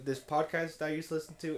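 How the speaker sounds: ordinary talk, nothing marked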